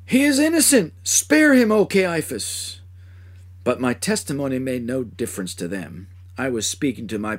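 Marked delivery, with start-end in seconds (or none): 0.1-2.8 much higher, louder anguished cry, the last words slowed; agonized outcry. Acted. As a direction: cry out in agony to the high priest. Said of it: "my hoarse voice rang out in terrible agony".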